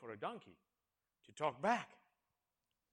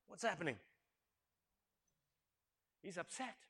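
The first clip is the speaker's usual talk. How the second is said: ordinary talk, nothing marked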